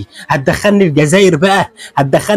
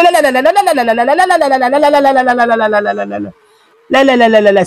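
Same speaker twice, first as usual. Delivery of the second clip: repeated no's, much higher, fading down; excited no no; 7 semitones higher than usual. Real, not acted. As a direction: cry out no over and over in disbelief. Reacting to brutal punches. (صرخة لا لا لا بصوت عالي النبرة)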